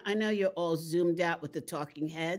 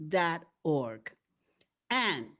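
ordinary talk

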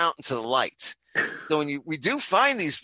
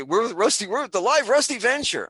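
excited fan; 9 semitones higher, 5 dB louder than usual. Retold or imitated.

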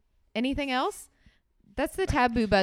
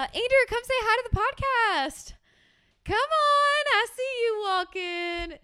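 high, sing-song call across room. Real, not acted. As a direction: call him over playfully. Calling son over to podcast.

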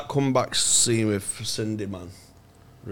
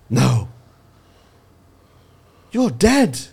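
mock shocked Kathy; much slower than usual. Retelling Kathy seeing Cindy, mock shock. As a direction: act out Kathy's disbelief, hammed up. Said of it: "she goes".

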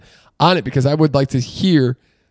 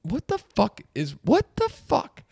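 inner disbelief; 12 semitones higher than usual. Retold or imitated. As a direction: stretch it out in disbelief, voice rising. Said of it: "in my head, I'm like".

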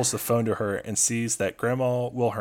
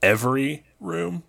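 slow, pointed, higher question; 6 semitones higher than usual. Retold or imitated. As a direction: ask it slowly and pointedly.

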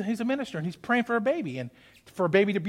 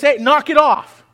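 louder sharp rebuke; scolding a dog; 4 semitones higher, 7 dB louder than usual. Acted. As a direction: get in the dog's face and snap it off. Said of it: "You get in his face".

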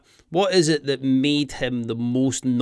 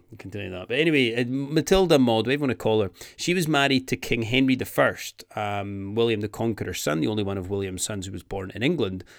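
ordinary delivery

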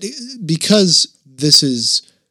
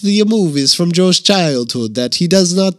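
louder, drifting mock-European accent; wobbly Italian-tinged accent; French turning Italian; 5 semitones higher, 4 dB louder than usual. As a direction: start French, slide into Italian. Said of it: "It's going back to Italian".

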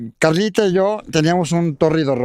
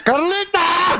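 much higher shouted name; yelling her name; 10 semitones higher, much slower than usual. Pretend. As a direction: cry her name out dramatically. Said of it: "Grita, grita mi nombre".

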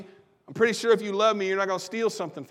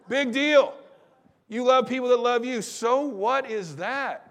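punchy, raised-voice "so what"; emphatic scoff; 5 semitones higher than usual. Retold or imitated. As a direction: scoff it out as a challenge. Paraphrases a dismissive scoff.

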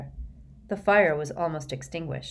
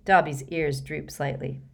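plain reading voice

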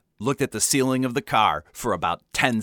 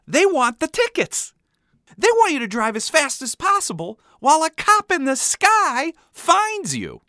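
high-pitched, mounting indignation; undeterred kid rant; 13 semitones higher than usual. A narrator's reading. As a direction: rant at Dad like a child exposing a conspiracy. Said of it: "I continued, undeterred".

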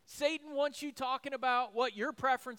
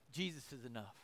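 hushed; 8 semitones lower, 13 dB quieter, faster than usual. Real, not acted. Tender climax of sermon.

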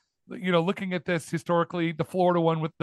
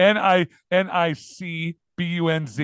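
spelled slowly; 2 semitones higher, slower than usual. Shown as a demonstration. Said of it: "That's how you should do it".

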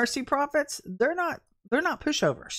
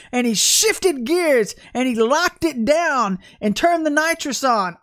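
louder, higher preaching, building fire; fiery preaching; 6 semitones higher, 5 dB louder than usual. Acted. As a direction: preach it with full force. Preaching at full force.

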